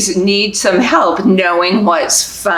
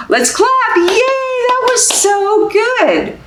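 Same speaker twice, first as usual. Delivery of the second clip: very high, slow, gushing cheer; sky-high praise for little ones; excited cheer; slower than usual. Acted. Librarian praising babies after the song, high gushing cheer.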